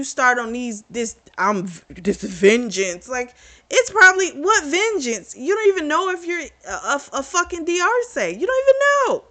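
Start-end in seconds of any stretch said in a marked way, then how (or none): 3.1-9.2 high-pitched incredulous outburst; exasperated rant. Real, not acted. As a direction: voice shooting up high and louder, disbelieving. Ranting at a character's stupid plan.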